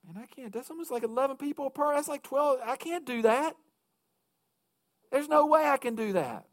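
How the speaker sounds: mock-overwhelmed church member balking at the goal; overwhelmed doubter. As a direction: play the daunted volunteer throwing up his hands. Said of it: "they'd be going".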